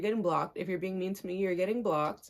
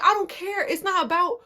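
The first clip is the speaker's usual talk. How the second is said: exasperated retort; 9 semitones higher than usual. Retold or imitated. Retold argument, cutting cousin off.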